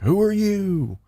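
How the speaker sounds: high-pitched imitation of a suspicious father; mock hostile dad. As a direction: demand it like a gun-toting stranger. Bearded dad with shotgun.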